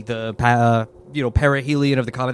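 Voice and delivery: ordinary delivery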